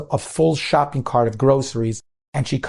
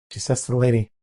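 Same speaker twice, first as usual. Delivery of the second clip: usual speaking voice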